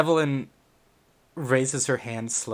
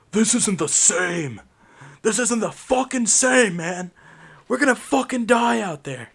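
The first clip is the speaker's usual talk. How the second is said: put-on much higher, loud, ranting old-timer; angry old man; 8 semitones higher, 6 dB louder than usual. Shaky old man rants at the group, high and loud. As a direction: shout like a fervent old veteran.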